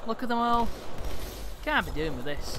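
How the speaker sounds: exaggerated Manchester-Liverpool accent; mock north-west accent; put-on northern accent. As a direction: grumble in put-on northern English. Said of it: "isn't in the doing the accents".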